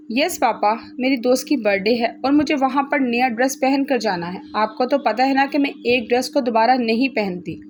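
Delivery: usual speaking voice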